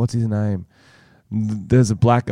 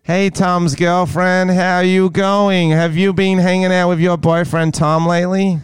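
American girlfriend impression; 8 semitones higher than usual. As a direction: draw it out in a higher girlfriend voice. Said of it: "he has to impersonate his mysterious American girlfriend".

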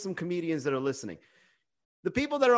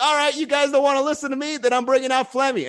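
raised, loud exasperated threat; mock threat; 8 semitones higher, 5 dB louder than usual. Bombing comic threatens the crowd with his puppet, fed up. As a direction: warn the crowd, pitched up and loud. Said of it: "They're like".

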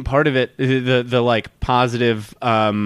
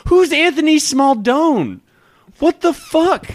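incredulous; 8 semitones higher, slower than usual. Real, not acted. Reading headline in disbelief.

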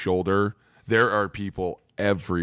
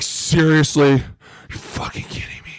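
retold indignation; 6 semitones higher than usual. Lifter told off mid-reps, retold indignation. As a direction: burst out, then seethe under the breath.

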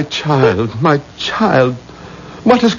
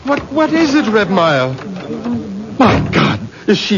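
high, alarmed panic; panicked; 9 semitones higher than usual. Acted. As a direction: fear the worst. Father fears daughter is dead.

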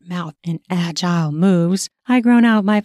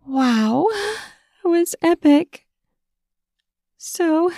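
breathless wow; 10 semitones higher, slower than usual. Acted. Breathless after climax.

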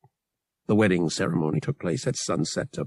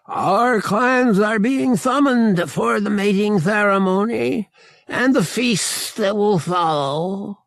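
higher, louder proud creature voice; alien Togorian male; 10 semitones higher, 4 dB louder than usual. Acted. Murg tells Han the clans are summoned, higher creature voice. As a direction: announce it like a big feline alien chieftain.

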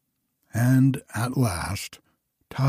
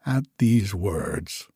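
plain reading voice

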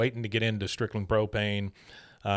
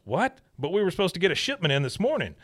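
higher, fast startled voice; alarmed surprise; 7 semitones higher, faster than usual. Retold or imitated. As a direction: blurt it out in shock. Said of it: "He goes".

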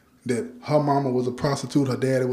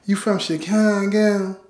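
slow, higher country girl imitation; imitated Memphis country drawl; country Southern accent; 5 semitones higher, slower than usual. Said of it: "Real country".

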